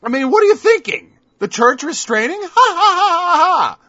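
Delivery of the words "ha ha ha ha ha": high, loud mocking ha-ha; scornful laugh. Real, not acted. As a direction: laugh the idea off with contempt. Laughing off the claim.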